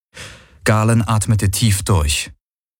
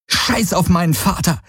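sharp, higher outburst; angry interruption; 11 semitones higher than usual. Acted. Galen cutting Kirai off about his father, angry. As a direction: cut her off hard.

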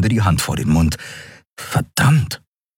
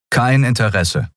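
firm voice; 4 semitones higher than usual. Acted. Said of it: "sagte Kai mit fester Stimme".

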